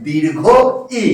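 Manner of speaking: "দীর্ঘ ই" with a pause, then ই held long; long vowel. Shown as a demonstration. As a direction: say "দীর্ঘ ই", stretching the final ই. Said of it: "উচ্চারণের সময়টা বেশি লাগে". (থেমে, ই টেনে "দীর্ঘ ই")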